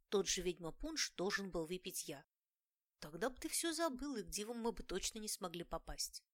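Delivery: usual speaking voice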